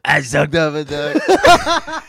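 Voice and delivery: hammed-up, deliberately bad politician impression; slow, loud, badly faked presidential voice; lightly mocking caricature, drawn out; Donald Trump. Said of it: "Yeah, Donald Trump".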